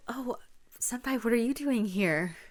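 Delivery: flustered at meeting a crush. Acted. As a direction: blurt it out, caught off guard.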